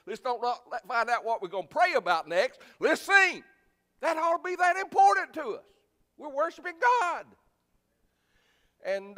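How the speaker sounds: loud, high, full-force preaching; fervent preaching. Acted. Pastor to congregation, urging them to sing out: loud fervent preaching. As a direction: preach it hard, rallying the church to sing.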